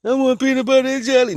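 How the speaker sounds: put-on high loud whiny kid; little nephew voice. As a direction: whine and insist like a child. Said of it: "nephew quote".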